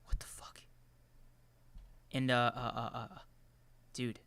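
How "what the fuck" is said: shocked whisper. Retold or imitated. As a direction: breathe it out in disbelief. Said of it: "And I was like".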